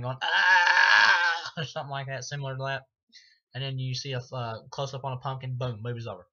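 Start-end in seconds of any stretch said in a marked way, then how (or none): 0.2-1.5 sudden high loud yelp of a scream; imitated scream. Reviewer imitating the actor's offscreen dying scream, loud and high. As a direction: mimic an old man's scream from offscreen. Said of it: "hear Donald Pleasance scream".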